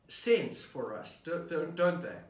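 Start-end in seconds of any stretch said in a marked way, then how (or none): none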